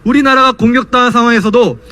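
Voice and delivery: normal talking voice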